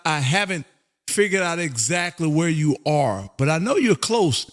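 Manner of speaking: ordinary delivery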